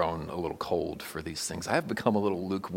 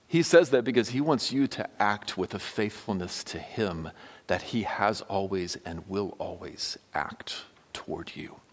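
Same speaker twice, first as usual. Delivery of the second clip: ordinary talk, nothing marked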